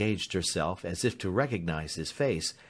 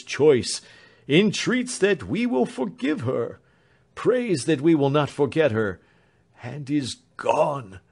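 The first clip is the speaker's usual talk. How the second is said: broken voice; 5 semitones higher, 6 dB louder than usual. A narrator's reading. The doctor breaks the news to Alfred, broken. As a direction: speak through grief. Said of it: "in a broken voice".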